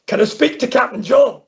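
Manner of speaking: mock Irish farmer on the phone; Irish caller. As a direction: put on the old farmer's Irish lilt. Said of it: "I'll tell you a wee story".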